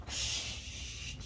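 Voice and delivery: soft whispered shush; calming shush. Real, not acted. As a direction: hush the mare gently. Calming a nervous wild horse.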